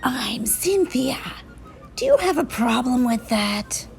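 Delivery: lightly voiced challenging monster; mildly menacing, raspy monster challenge; indignant monster. A narrator's reading. Offended monster challenges the child.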